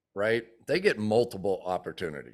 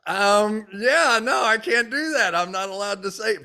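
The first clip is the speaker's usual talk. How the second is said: high, loud awkward laugh-through; sheepish laughing excuse; 10 semitones higher, 5 dB louder than usual. Retold or imitated. Telling the angry receptionist he can't cheer, laughing sheepishly. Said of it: "I go".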